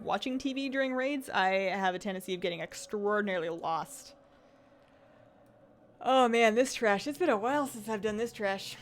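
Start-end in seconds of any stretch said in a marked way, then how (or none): none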